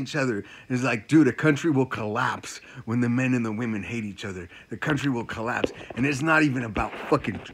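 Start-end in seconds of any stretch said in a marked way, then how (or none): none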